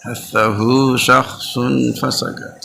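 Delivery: slow, drawn-out mnemonic chant; slow recitation. Shown as a demonstration. Teacher to class, slowly chanting the ten hams letters. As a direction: spell out হাসাহু শাখসুন ফাসাকাত slowly, syllable by syllable. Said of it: "এই খেয়াল করুন".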